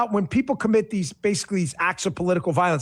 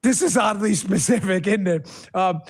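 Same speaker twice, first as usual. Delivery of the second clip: ordinary delivery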